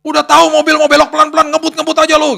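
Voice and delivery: high, loud yelling motorist; furious street yell at the courier; angry shout. Retold or imitated. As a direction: bark it out like an angry rider. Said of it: "dimarah-marahin lah pokoknya".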